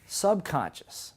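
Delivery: subconscious drawn out slowly, syllable by syllable; slow teaching word. Shown as a demonstration. Teacher introducing a new term, slow. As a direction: stretch subconscious out for the class. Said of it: "It's called the".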